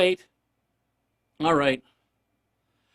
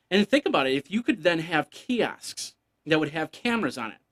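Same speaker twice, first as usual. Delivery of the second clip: ordinary talk, nothing marked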